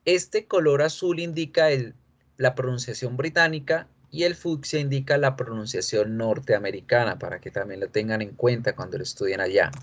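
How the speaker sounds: normal talking voice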